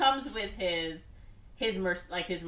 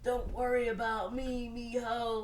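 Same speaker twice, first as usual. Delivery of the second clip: mock wounded friend; 5 semitones higher than usual. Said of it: "And he's like".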